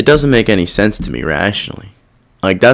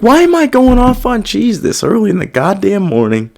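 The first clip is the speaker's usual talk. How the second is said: incredulous inner voice; 8 semitones higher than usual. Retold or imitated. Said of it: "It's like".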